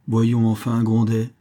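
ordinary delivery

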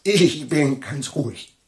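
forced calm. Acted. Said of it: "Beruhigen Sie sich".

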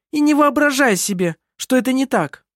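high, heated scolding; spiteful warning. A narrator's reading. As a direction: drive the insult home angrily. Kerstin joins attack on Anna Maria.

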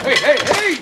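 high alarmed yells of hey; alarmed shout. Acted. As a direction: shout to stop him at once. Sudden alarm during quarrel.

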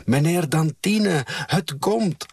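put-on high-pitched child's call, held in volume. A narrator's reading. Child at the farmhouse door, calling for Meneer Dantine. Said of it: "riep nog eens".

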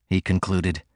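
ordinary delivery

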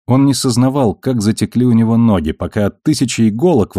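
ordinary delivery, nothing marked

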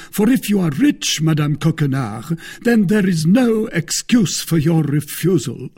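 high, loud indignant accusation; Porthos, offended. Acted. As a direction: play the affronted suitor.

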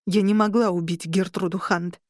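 plain reading voice

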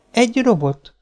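put-on high child's answer; little girl. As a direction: pipe up as a small girl.

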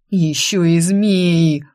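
loud, slow wail of dread; drawn-out acted moan. A narrator's reading. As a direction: groan it out in dismay. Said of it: "простонал сзади меня Данила".

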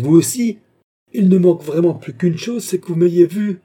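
high, loud, incredulous protest; indignant outburst. A narrator's reading. Queen turning on André who doubts her, indignant outburst. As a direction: throw it back loudly, pitched right up.

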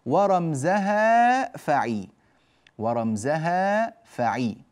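slow, stretched verse reading; verse recitation. Shown as a demonstration. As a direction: recite it slowly, lengthening the vowels. Reciting verse slowly for students.

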